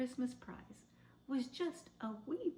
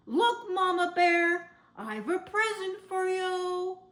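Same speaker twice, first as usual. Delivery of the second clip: proud excited call; 10 semitones higher, 11 dB louder than usual. A narrator's reading. Barnaby presents tree to wife.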